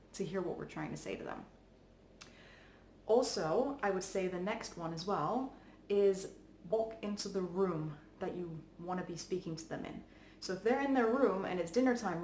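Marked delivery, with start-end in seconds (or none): none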